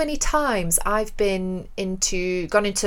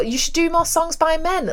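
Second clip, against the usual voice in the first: put-on higher, incredulous requote in own accent; indignant echo; 9 semitones higher than usual. Musician repeating a punter's sexist advice, high-pitched indignation. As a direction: throw the words back in disbelief.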